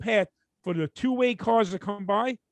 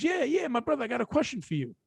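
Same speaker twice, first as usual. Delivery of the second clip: pushy driver; 6 semitones higher than usual. Retold or imitated. Retold driver accosting the storyteller.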